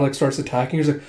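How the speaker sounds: ordinary delivery, nothing marked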